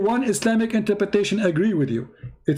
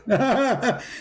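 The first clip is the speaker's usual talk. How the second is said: mocking laugh; 3 semitones higher than usual. Real, not acted. Laughs at opponent's answer.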